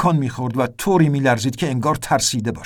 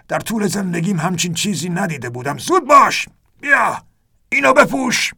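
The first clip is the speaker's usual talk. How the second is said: urgent shout; 5 semitones higher than usual. A narrator's reading. Ernest yelling at the narrator to put on the vest, frantic. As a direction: hurry him up, yelling over the storm. Ernest urges during storm.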